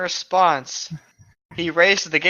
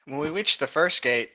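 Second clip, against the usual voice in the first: ordinary delivery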